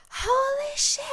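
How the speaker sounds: high, drawn-out squeal; excited friend. Retold or imitated. Friend reacting to the speaker's new job, high excited squeal. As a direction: draw it out high in delighted shock. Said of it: "she was like".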